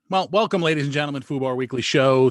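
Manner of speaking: loud showman intro; announcer welcome. Acted. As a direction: restart the big welcome. Restarting show opening.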